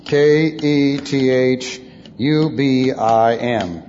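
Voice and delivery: drawn-out dictation of single letters; spelling out. Real, not acted. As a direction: spell it slowly for note-takers. Spells out Hebrew term.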